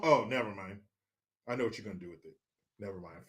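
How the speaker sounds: soft muttered aside; quiet muttering to himself; trailing mutter. Real, not acted. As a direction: drop to a mutter under the breath.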